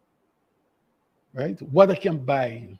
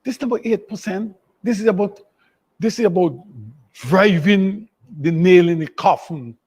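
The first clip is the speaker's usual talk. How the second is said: impassioned outcry; 6 semitones higher, 9 dB louder than usual. Real, not acted. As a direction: hammer it home passionately. Impassioned outcry over costs.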